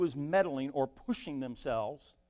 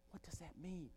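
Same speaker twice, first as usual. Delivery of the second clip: very soft, puzzled aside; hushed aside; 3 semitones higher, 17 dB quieter than usual. Retold or imitated. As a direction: drop to a near-murmur for a private question. Retold private puzzled thought at the door.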